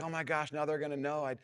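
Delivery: self-conscious dad fretting on a surfboard; embarrassed panic. Retold or imitated. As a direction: rattle it off like you're mortified. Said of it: "I'm so embarrassed".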